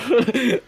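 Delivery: delighted high-pitched laugh. Real, not acted. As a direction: burst out laughing at your own scheme. DM laughs at own plan.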